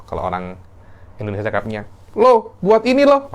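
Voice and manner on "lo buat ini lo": high, loud bossy order; pitched-up, forceful intonation of authority; superior commanding tone. Shown as a demonstration. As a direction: order someone about as their superior. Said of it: "Isti'la itu intonasi kata-katanya".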